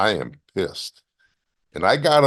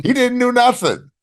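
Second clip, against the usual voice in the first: much higher and louder, amazed; incredulous outburst; 6 dB louder than usual. Real, not acted. Wrestler telling the host Ming never hit back, high amazed outburst. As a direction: blurt out the disbelief. Disbelief that Ming didn't retaliate.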